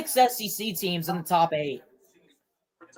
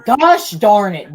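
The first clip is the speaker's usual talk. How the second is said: frustrated outburst; 9 dB louder, slower than usual. Real, not acted. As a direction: vent real frustration at the draw. Team drawn a tough opponent.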